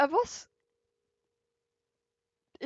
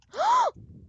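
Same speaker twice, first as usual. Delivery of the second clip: sharp high gasp; 5 dB louder than usual. Real, not acted. Halètement après noyade.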